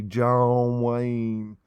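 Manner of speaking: slow, drawn-out cowboy impression; John Wayne. Reader joking about being an actor, slow mock movie-star voice. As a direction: stretch the name out like a film cowboy. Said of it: "I should be an actor".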